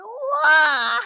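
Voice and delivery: hammed-up crying wail; mock sob burst; fake wail. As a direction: let out a fake baby wail. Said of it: "You might be trying to mock me".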